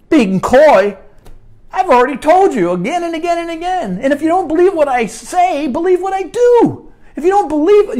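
Jesus answering the crowd, voiced high and loud; preaching at full force. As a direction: drive it home like a revival preacher.

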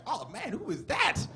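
hammed-up thrilled exclamation; excited teen. Fifteen-year-old gawking at a topless woman, hammed-up excitement. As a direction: build from marvelling to loud delight.

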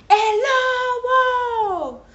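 high, loud mock alarm; boy crying wolf, full voice; shout. A narrator's reading. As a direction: yell it out long and high for fun. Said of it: "Juan gritaba".

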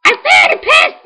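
slow, very high squeal; terrible mocking voice. Gamer mocking Chikorita, terrible high squeaky voice. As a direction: squeak it slowly in a deliberately awful falsetto. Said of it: "I'm doing my terrible voice".

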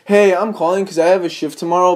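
usual speaking voice

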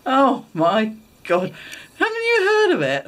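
mock-shocked, climbing to a squeak; scandalised disbelief. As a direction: imitate a husband utterly aghast. Husband's shocked reaction, retold.

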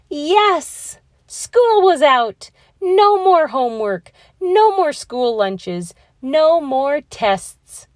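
high, loud, slow triumphant cheer; excited cheering. Acted. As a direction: celebrate like school just ended. School's out, kids cheer.

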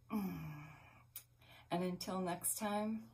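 slowly and softly send love; tender sign-off. Real, not acted. Closing the video to her listeners: slow, tender.

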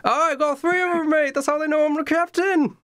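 high, far-off Cockney pirate captain, friendly but bossy; the captain calls out to his new recruit, high and mocking. GM as pirate captain greeting a fisherman, high cheeky voice.

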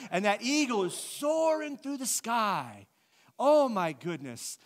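loud, drawn-out gushing wonder; hammed-up awe, swooping high and big; mock rapture. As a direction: proclaim it slowly like an overwhelmed amateur poet. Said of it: "You ever heard people talk like this".

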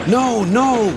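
high, drawn wail of alarm. A narrator's reading. Empty cars warn the others, wailing. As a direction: cry out high in protest. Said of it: "wailed the empty cars".

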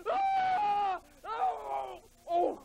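high loud anguished wail. Acted. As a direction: wail in mock despair. Mock breakdown wail.

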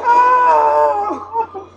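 sudden loud high yelp; a loud startled ah; startled shout. Real, not acted. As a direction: jump and yell in surprise.

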